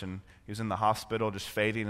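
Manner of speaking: ordinary talk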